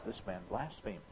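hushed, muttered charge under the breath; whispered accusation. A narrator's reading. Scribes murmur to each other, hushed. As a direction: scribes whispering among themselves. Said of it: "began to whisper to themselves".

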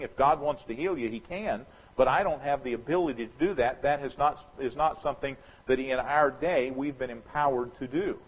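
ordinary delivery

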